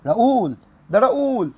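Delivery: high, loud cry of the name; real thrill at pulling Raul; excited shout. Real, not acted. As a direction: shout the name in disbelief and joy. Reveal shows Raul.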